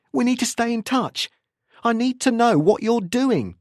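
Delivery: high, heated demand; agitated insistence. A narrator's reading. Anderson demanding contact from Tim, agitated and insistent.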